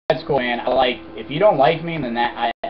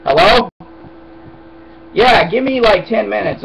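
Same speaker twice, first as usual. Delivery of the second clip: loud, higher, still-worked-up hello; loud phone answer; 8 semitones higher, 10 dB louder than usual. Real, not acted. Answering the phone after yelling, loud and higher. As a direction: bark into the phone still heated.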